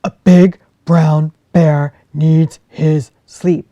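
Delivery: loud, gruff big-bear voice; a big bear sending the fox away, loud and grumpy. Acted. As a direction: shoo her off like a cross old bear. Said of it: "said Jefferson Bear".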